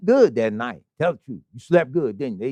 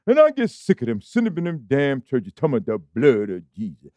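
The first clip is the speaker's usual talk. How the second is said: mocking the brother; 4 semitones lower than usual. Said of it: "That's what the brother said".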